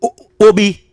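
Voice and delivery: rising to a high, impassioned pitch; getting worked up, voice climbing; heated outrage. Real, not acted. Caller heated about threat to PM.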